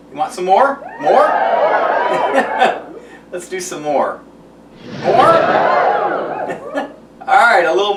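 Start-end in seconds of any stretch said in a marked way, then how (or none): 1.3-3.0 giggle. Real, not acted. Laughing while ladling sauce. 5.2-6.9 giggle. Real, not acted. Laughing while ladling sauce.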